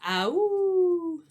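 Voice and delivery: high, loud coyote howl. A narrator's reading. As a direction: throw your head back and howl. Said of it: "the coyote says".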